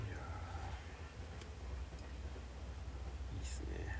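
breathy whispered murmur; whispering. Real, not acted. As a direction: whisper it to yourself. Quiet aside while eating.

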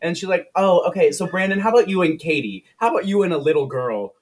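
high, cooing reassurance; soothing director. Retold or imitated. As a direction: imitate a perky adult placating a small child. Director soothing kid, pairing up children.

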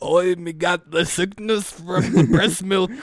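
dim-witted mock olden-day yokel; put-on caveman-English voice; stupid person of old. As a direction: play a silly ancient simpleton. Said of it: "imagine what the stupid people sounded like".

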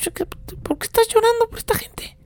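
scolding angel. Retold or imitated. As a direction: scold him like an outraged angel. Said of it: "casi, casi le da un zape y le dice". (el ángel regaña a Pablo, agudo y exasperado)

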